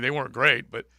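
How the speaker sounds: usual speaking voice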